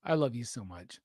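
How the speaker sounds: a low, soft unvoiced whisper; whispered affection to the stream; whispered love. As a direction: breathe it out as a hushed joking confession. Tender aside after teasing.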